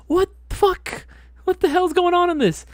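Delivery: very high, loud incredulity; retold shock. Recruit seeing the bloody chair, high-pitched horrified disbelief. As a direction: squeal it in mock horror.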